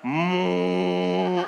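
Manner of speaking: long, loud droning moo; cow imitation. Erap hiding in a tree, pretending to be an animal, mooing like a cow. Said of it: "Alam ko na kung anong hayop".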